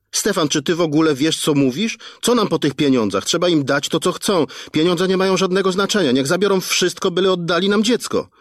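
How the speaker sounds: high, fraught wife's voice; reproachful wife. Acted. Wife blaming her husband for calling the police, frantic. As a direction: accuse him in fear for the son.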